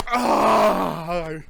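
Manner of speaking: long, loud groan of pain. Real, not acted. Reviewer suffering through pepper burn, loud long groan. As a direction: let out a drawn-out agonized "ah". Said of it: "I'm in so much pain".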